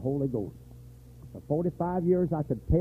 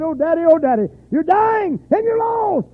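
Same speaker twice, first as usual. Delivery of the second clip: pleading daughter; 11 dB louder than usual. Retold or imitated. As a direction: plead with a dying father. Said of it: "went to her father's bedside and said".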